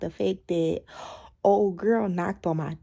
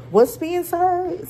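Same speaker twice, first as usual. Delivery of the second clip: slow, higher, drawn-out challenge; incredulous challenge; 9 semitones higher, much slower than usual. Retold or imitated. Facing the neighbour at the door, a slow, higher, incredulous challenge. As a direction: stretch it out like she dares you.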